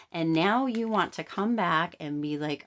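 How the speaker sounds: ordinary talk, nothing marked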